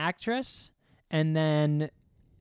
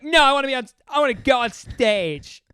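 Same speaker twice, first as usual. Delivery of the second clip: put-on drunk woman; 9 semitones higher, 7 dB louder than usual. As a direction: whine like a drunk who won't let go. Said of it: "she is so drunk".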